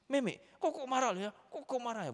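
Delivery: put-on very high-pitched little boy's scolding; child copying angry father. Six-year-old scolding little sister just like dad, imitated high and angry. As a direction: mimic a child's mock telling-off. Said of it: "Marahnya itu persis seperti saya gini".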